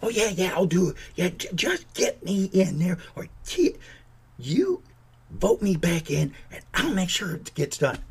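a hint of a fawning politician, partly whispered; lightly voiced vote-seeking politician; mock campaigning politician. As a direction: promise everything like a candidate begging for votes. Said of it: "Oh, but when it's election year".

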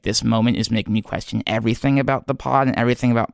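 ordinary talk

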